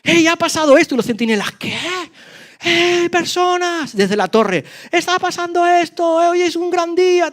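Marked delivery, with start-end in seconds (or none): 0.0-0.9 high, loud calling shout; shouting at full voice. Acted. Lepers shouting the news to the sentinels, loud high call. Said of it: "gritando a viva voz". 1.5-4.0 high, slow drawn-out call from the tower. Acted. Sentinels calling down from the tower, high slow call. 4.9-7.3 high carrying call. Acted. Lepers calling up to the tower, high carrying call.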